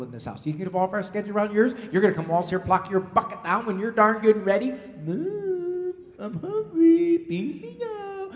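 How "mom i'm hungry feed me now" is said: put-on much higher, slow spoilt-child whine; mock the demanding kid; mother mocking her son's demands in a whiny kid voice. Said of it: "mimicking a whiny kid".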